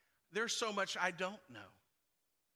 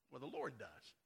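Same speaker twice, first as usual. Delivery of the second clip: hushed reverence; 10 semitones lower, 12 dB quieter than usual. Acted. Preacher drops voice reverently.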